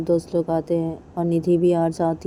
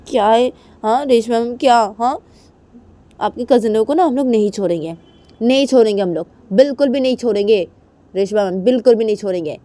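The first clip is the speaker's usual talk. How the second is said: higher, louder furious vow; angry threat; 5 semitones higher, 8 dB louder than usual. Acted. Nidhi vows revenge on Reshma's cousins, loud and furious. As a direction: spit out the threat.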